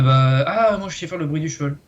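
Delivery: usual speaking voice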